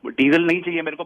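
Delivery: ordinary talk, nothing marked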